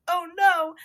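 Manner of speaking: loud, high retold "oh no"; exaggerated panic, imitating the film character; mock dismay. Retelling film character caught kissing.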